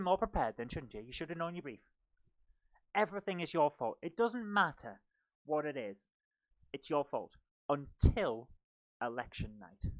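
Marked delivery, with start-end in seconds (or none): none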